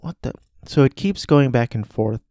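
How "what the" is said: hushed disbelief. Retold or imitated. She's like.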